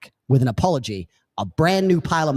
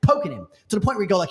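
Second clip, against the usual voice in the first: ordinary delivery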